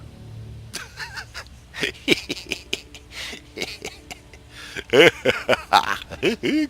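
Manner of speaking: high, manic put-on cackle; crazy laugh. Player testing face tracking, crazy mock cackle. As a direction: cackle madly, climbing in pitch. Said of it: "Crazy, crazy eyes".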